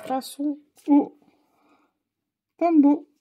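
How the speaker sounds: a showman's buildup to the brand name; excited announcement. Real, not acted. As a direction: announce the Tombow like a big reveal. Announces the next product.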